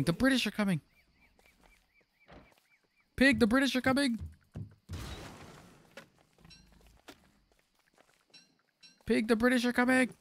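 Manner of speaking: high mock alarm call; mock alarm cry. Mock Paul Revere warning cry.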